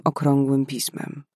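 ordinary delivery

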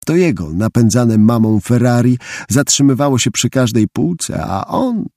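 ordinary delivery

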